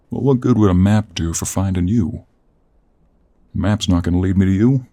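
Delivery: loud, frustrated complaint; exasperated. Acted. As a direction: raise your voice in exasperation at the one you blame. Exasperated at the captive while lost.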